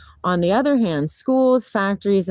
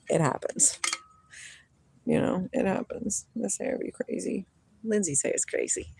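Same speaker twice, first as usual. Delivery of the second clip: much softer, breathy mutter; whispered aside; 10 dB quieter than usual. Real, not acted. Quiet aside to herself.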